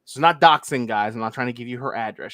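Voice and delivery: normal talking voice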